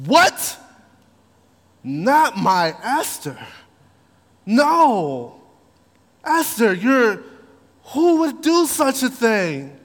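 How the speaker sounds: high, loud outraged disbelief; shocked king. Retold or imitated. King hearing someone wants his queen dead, loud and shocked. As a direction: cry out in alarm for your queen.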